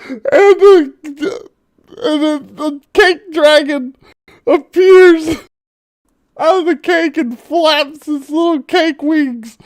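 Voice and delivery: very high, put-on storyteller voice; high-pitched narration. Game master unveils the cake dragon, high-pitched narration. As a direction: narrate it all up in a squeaky register.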